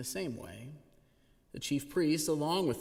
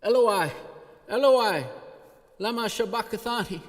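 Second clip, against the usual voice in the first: anguished cry, much louder and higher; 9 semitones higher, 10 dB louder than usual. A narrator's reading. Jesus crying from the cross, loud anguished call. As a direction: cry out to heaven in despair. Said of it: "cried out with a loud voice".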